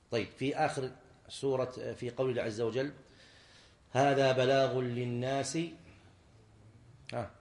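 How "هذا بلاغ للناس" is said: slow, level, drawn-out verse recital; measured scriptural quotation; Quranic recitation. Shown as a demonstration. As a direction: recite slowly and steadily, leaving it open. Teacher recites verse to quiz class.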